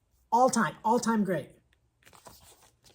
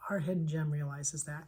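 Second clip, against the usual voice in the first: hushed, breathy whisper; a soft whispered payoff; 5 semitones lower, 8 dB quieter than usual. Real, not acted. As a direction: let it fall to a whisper. Soft closing line on book.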